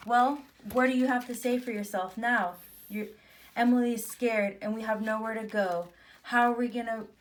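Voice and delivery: loud, mildly cross questioning; indignant scolding. Acted. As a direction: confront her and demand answers. Sister confronts the mouse explorer.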